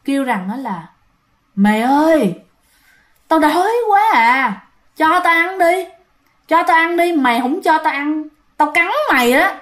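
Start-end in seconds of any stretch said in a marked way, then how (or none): 1.5-8.2 hungry ghost voice. Retold or imitated. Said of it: "cái bà này mới nói". 8.6-9.5 higher eerie threat to bite; ghost threat. Retold or imitated. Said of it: "cái bà này mới nói".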